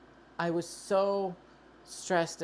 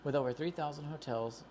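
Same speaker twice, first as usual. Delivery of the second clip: ordinary talk, nothing marked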